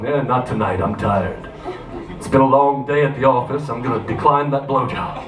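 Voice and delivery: put-on low, tired boyfriend excuse; hammed-up end-of-day fatigue, pitched low; mock weariness. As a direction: drop the pitch and beg off wearily.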